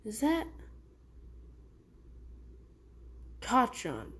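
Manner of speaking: soft, hushed, then rising in disbelief; barely daring to believe it's him; stunned recognition. Acted. Deku recognises his lost friend.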